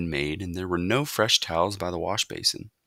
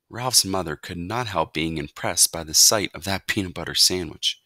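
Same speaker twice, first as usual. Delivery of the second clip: ordinary delivery, nothing marked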